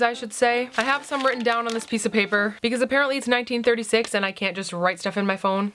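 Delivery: normal talking voice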